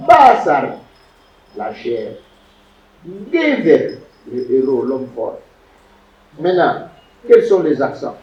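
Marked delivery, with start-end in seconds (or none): 0.1-0.8 "Basar" with BA high and loud, falling away; BAA-sar, a teacher's stressed first syllable in "Basar". Shown as a demonstration. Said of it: "l'accent visible pour que tout le monde". 3.3-4.0 "Gever" with GE pitched high, then falling; GEE-ver, high first syllable in "Gever"; stress on first syllable. Shown as a demonstration. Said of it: "l'accent visible pour que tout le monde".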